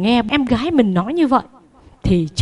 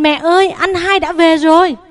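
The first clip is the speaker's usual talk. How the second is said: higher, excited call across the house; 7 semitones higher, 3 dB louder than usual. Acted. Little sister calling mother that her brother is back, excited call. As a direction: shout the news to mother that brother is home. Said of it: "la lên".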